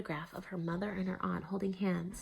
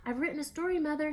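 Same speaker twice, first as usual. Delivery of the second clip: put-on high, eager child's call to mother; 8 semitones higher, 4 dB louder, faster than usual. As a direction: call across the room, hoping. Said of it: "she called".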